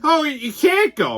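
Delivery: pleading organizer. Retold or imitated. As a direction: whine like the panicked organizer. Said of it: "organizer pleads".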